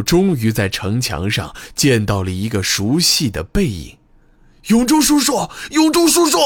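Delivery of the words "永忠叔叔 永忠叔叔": desperate scream, much higher and louder; heart-rending scream. A narrator's reading. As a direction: scream his name with everything you have. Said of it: "木武撕心裂肺地叫喊起来".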